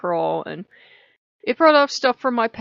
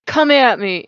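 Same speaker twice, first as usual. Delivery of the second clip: louder, a defiant dare; mock challenge; 4 semitones higher, 9 dB louder than usual. Describing waking up angry, a loud mock challenge. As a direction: throw it out like picking a fight. Ready to fight anyone.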